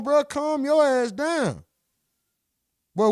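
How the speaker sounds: ordinary delivery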